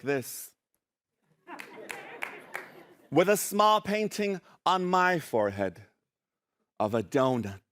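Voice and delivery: measured reading with dramatic pauses; poetry recitation. Acted. Poet reading a Frida Kahlo poem to a crowd, theatrical recitation. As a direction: recite it deliberately, pausing before each title.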